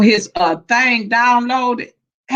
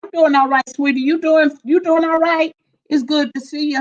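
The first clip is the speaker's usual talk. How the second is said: ordinary talk, nothing marked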